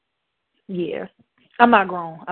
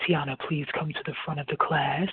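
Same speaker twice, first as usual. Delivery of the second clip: lightly imitated authoritative teacher, lower and softer; composed, lowered grown-lady voice, mildly put on; 7 semitones lower, 6 dB quieter than usual. Teacher calls pupil to the front, mock authoritative. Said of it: "why you so authoritative".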